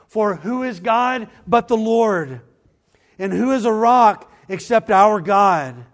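loud, high proclaiming of the psalm; fiery pulpit proclamation; preaching at full force. Acted. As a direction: thunder it out to the whole church.

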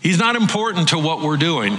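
a fed-up host wants the dog out now; exasperated order. Retold or imitated.

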